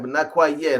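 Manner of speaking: normal talking voice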